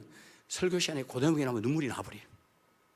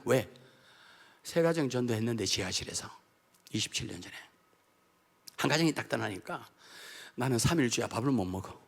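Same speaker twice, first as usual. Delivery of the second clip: ordinary talk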